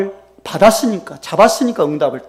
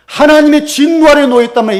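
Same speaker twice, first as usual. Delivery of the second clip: usual speaking voice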